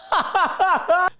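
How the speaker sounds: a sudden, high, loud laugh that breaks out; burst of high laughter. Real, not acted. Vlogger cracks up at the girl's reply, laughing loudly. Reacting to the trad wife reply.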